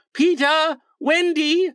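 high, drawn-out searching call; high calling shout, held back in volume; shouting names. A narrator's reading. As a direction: call out for missing friends.

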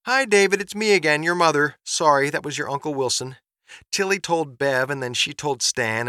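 chatty excited mother. Acted. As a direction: bubble over with news for your son. Mother's third message.

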